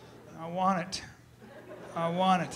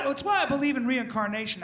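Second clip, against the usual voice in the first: usual speaking voice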